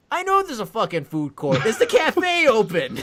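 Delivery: high, loud exasperation breaking into a laugh; mock fury cracking into laughter; exasperated snap. To the confused guard, loud high exasperation with a laugh.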